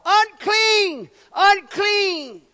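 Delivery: loud, high-pitched warning cry; full-voiced, drawn-out shout that falls at the end; hollering out. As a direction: holler it like an outcast warning passersby. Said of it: "they had to holler out".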